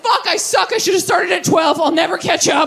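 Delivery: high, exasperated wail at herself; mock despair over a hopeless song; frustrated self-talk. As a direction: whine it like you just gave up. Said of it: "I'm like".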